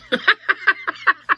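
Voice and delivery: high, rapid burst of laughter; high cackle. Real, not acted. Fan laughing at the mentor trip, high cackle. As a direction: cackle in disbelief.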